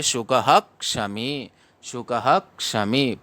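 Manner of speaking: सुखः with a quick, stressed ह; teacher stresses ह in सुखः क्षमी. Shown as a demonstration. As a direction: hit the ह in सुखः fast and strong. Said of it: "ह पर बल देंगे".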